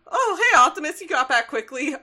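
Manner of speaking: bright, high, loud hello; cheery greeting. Retold or imitated. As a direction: greet him with cheerful surprise. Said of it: "Ratchet's like".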